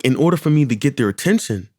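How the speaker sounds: ordinary delivery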